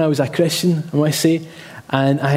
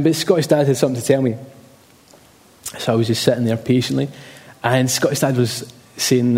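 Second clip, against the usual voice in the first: usual speaking voice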